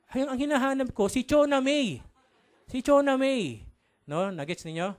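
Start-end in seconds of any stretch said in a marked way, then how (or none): 0.1-3.7 high, loud punchline delivery; exclaiming mother. Acted. Mother telling the reporter whom she seeks, loudly exclaiming. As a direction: call the name out loud and high. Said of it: "Sabi naman ng babae".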